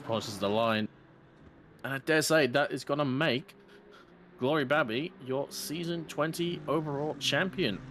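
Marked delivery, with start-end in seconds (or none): 1.9-7.8 race caller. Acted. Announcing the champion.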